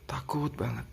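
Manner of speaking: whispered, hushed fear. Retold or imitated. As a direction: drop it to a frightened whisper. Repeated fear phrase, whispered. (bisikan ketakutan)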